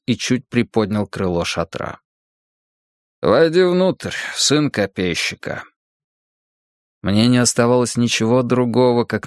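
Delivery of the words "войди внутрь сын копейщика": повелительно, но спокойно; приглашение от воина-монаха; голос монаха. Acted. Монах зовёт героя в шатёр. (сдержанный голос монаха)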